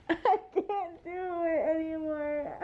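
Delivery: real laughter breaking through the words; laughing. Real, not acted. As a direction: laugh it out, fed up and giddy. Laughing, done with romance books.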